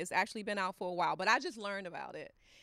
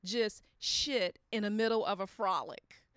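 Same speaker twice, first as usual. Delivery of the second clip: ordinary delivery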